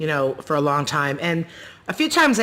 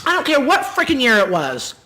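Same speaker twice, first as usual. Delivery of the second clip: mad at mom; 7 semitones higher than usual. Retold or imitated. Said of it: "I would get mad at her and be like".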